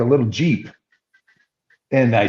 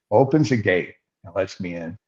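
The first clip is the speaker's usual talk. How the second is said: usual speaking voice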